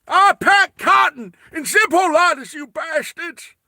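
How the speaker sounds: put-on high, loud cranky American old man. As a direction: shout it like a grumpy old American. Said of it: "Whenever I do an old man voice, it's American".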